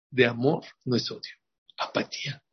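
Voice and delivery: apatía whispered almost without voice; preacher whispering the word apatía; hushed punchline. Shown as a demonstration. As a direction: drop to a breathy whisper on apatía.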